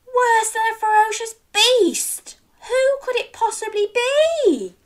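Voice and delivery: high wondering question; mock astonishment. A narrator's reading. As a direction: ask in wide-eyed wonder. Suspense question to child.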